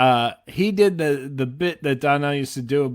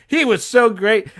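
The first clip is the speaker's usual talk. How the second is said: imitated enthusiastic dad; 8 semitones higher, 4 dB louder than usual. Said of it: "he was like".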